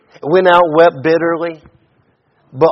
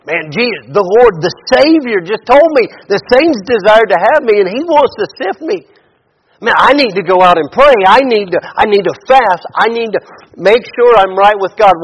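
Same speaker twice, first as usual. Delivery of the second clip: urgent, higher and louder resolve; earnest Peter; 5 semitones higher, 6 dB louder than usual. Retold or imitated. Preacher voicing what Peter should have said, urgent and earnest. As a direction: voice Peter suddenly alarmed and eager to pray. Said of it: "Instead of saying".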